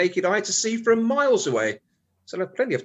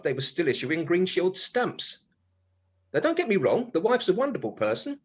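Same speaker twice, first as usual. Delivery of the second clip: ordinary talk, nothing marked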